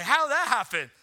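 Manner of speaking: loud, high, baffled question; incredulous. Retold or imitated. Pastor voicing his first baffled thought about his daughter, loud and incredulous. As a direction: blurt it out like you can't believe it. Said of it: "that's the first question I have".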